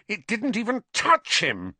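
high-pitched, indignant denial; heated, emphatic self-defence; shaking with anger. A narrator's reading. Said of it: "his voice shaking with anger".

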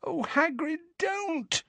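very high, soft pleading moan; small high-pitched plea; anxious moan. A narrator's reading. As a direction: moan it in dread. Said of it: "moaned Hermione".

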